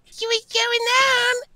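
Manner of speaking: very high squeaky dog voice; childhood dog's voice. Retold or imitated. Guest voices his childhood dog, very high pitched. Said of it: "So that's the weirdest voice I do".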